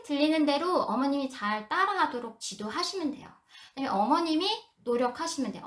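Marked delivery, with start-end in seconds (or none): none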